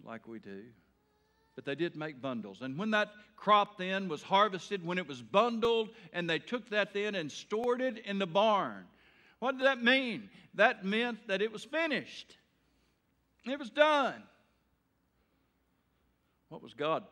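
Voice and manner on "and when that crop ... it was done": loud, high, building to a shout on done; fiery preaching. Acted. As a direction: preach it with rising force.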